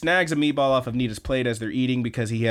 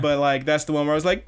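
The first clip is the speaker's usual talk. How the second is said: usual speaking voice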